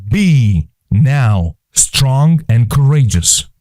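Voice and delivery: slow, deliberate command with BE and NOW set apart; measured, word-by-word "Be now strong and courageous"; God. Acted. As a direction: give "Be" and "now" each their own weight. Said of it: "No, no, God says".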